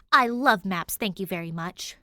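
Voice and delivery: a loud, indignant burst on love maps, then cooler; mock-offended defense of maps; sharp, affronted, then prim. A narrator's reading. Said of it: "looks at him with offense".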